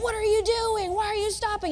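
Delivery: pitched high, exasperated outburst; mock angry yell. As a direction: yell at the stubborn donkey in mock anger. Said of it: "he's yelling at the donkey".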